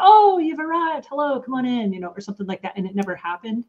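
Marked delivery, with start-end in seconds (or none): 0.0-1.9 cheerful welcome. Pretend. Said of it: "stop the conversation and go".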